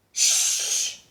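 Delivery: loud unvoiced shh; loud shush. A narrator's reading. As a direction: hush the bear. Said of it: "said the moles".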